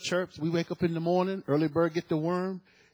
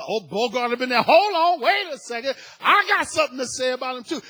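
excited testimony; 6 semitones higher, 9 dB louder than usual. Retold or imitated. Sherry bursting in to testify.

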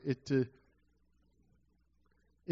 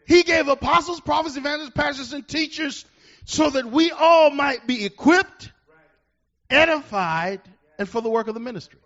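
preaching at full force; 10 semitones higher, 8 dB louder than usual. Real, not acted. Pastor booming at the congregation, preaching at full force. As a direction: build to a big shout to the church.

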